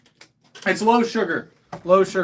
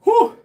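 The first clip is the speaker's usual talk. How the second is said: high whew blowing out heat; sharp exhale; 6 dB louder than usual. Real, not acted. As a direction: exhale hard after the burn. Reacting to spicy sauce.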